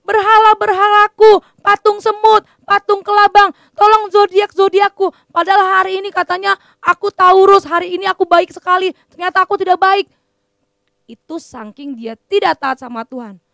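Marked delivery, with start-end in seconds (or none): 0.0-10.0 higher and louder, a king begging to his idols; panicked shouting plea for rescue; desperate cry for help. Acted. (teriakan panik minta tolong)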